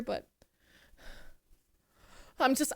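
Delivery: ordinary talk, nothing marked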